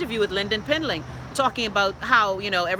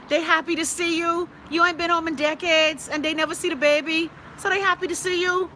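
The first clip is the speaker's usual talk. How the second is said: much higher, teasing chide to a touchy viewer; sing-song mock reproach, pitched way up; playful scolding; 9 semitones higher than usual. Real, not acted. Host chiding Daniel to be glad family visits, high teasing scold.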